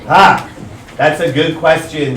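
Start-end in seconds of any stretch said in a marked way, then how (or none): 0.1-0.5 sharp "ha" laugh bark; one loud burst of laughter; loud single laugh. Real, not acted. Teacher reacts to question.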